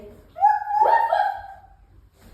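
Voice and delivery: very high, loud puppy-like woofs; dog woof. Pretend. Mom in down dog with her child, high playful woofs. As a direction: yelp out two playful little barks. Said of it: "What'd your dog say?".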